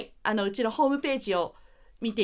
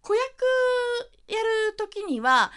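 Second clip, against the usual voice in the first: put-on high small child voice; 11 semitones higher than usual. Shown as a demonstration. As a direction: talk from the front of the mouth. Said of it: "前の方だけで喋るっていう".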